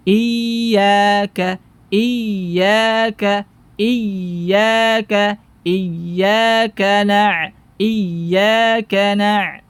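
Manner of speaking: "iyyaka" with the ya sound left flowing, not cut off; "iyyaka na-" slow, letting the voice run through the ya. Shown as a demonstration. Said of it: "biarkan aliran suaranya". ("iyyaka" dengan sifat rokhawa, suara mengalir)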